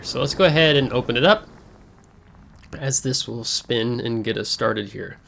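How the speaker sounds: usual speaking voice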